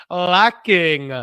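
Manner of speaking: "lacking" drawn out slowly and loud; theatrical emphasis on a stretched "lacking"; stress on lacking. Acted. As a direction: lean hard on "lacking", stretching it out. Dramatic reading of a comment.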